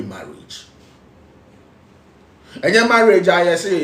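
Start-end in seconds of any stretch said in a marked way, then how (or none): 2.6-3.8 loud high preacher's cry; preaching shout. Real, not acted. Preacher shouting at couples about marriage. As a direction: shout it out to the congregation.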